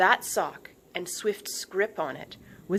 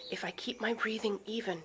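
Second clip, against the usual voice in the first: whispered, softer self-talk; to herself; 3 semitones higher, 7 dB quieter than usual. A narrator's reading. Swift telling herself to stay calm. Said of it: "she told herself".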